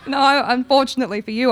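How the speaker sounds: loud words through real laughter; laughing refusal. Real, not acted. Actress letting a fan down, loudly laughing through it. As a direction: turn them down while still laughing.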